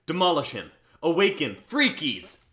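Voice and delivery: loud commanding shout; battle command. Acted. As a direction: bark the summons like a battle cry. Summoning monsters in battle.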